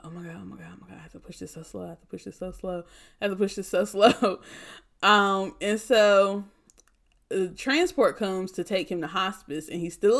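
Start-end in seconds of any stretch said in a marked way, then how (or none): 0.0-4.4 panicked inner voice. Retold or imitated. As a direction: race through it under your breath like a nervous student. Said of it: "But I'm like".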